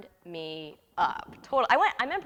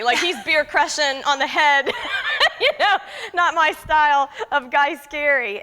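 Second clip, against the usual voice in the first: laughs through the words, a bit slower; laughing while talking; 7 semitones higher, 10 dB louder, slower than usual. Real, not acted. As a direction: chuckle all the way through it. Laughing at own story.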